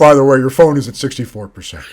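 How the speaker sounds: loud, quick robotic readout; RoboCop voice. Acted. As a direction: announce it like a cyborg cop. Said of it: "That's a really good RoboCop voice".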